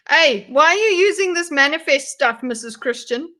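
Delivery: loud, high call-out, easing off; teasing accusation; mock challenge. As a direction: playfully confront her as if catching her out. Teasing challenge to the video's speaker.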